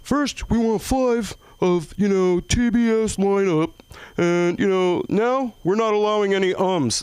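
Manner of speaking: announcer rule. Retold or imitated. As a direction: read the rule like an emcee. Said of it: "they go".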